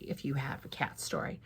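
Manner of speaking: soft unvoiced aside; whispered invitation. Real, not acted. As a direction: lean in and hush it.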